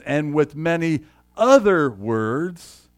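slow, drawn-out reading of the verse; slow emphatic reading. Shown as a demonstration. Pastor rereading the key verse to the congregation, slow and deliberate. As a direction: dwell on many other words. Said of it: "when he says".

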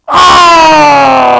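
long, loud, high wail; crowd's anguished cry. Retold or imitated. Preacher imitating people in the stands, long loud wail. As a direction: wail like fans who just lost. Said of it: "people in the stands going".

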